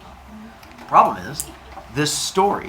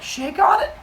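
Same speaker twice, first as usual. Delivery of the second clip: put-on much higher, sweet little-kid question; hammed-up cute baby voice; eager kid. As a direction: ask like an innocent child sealing a deal. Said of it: "And Hermes is like".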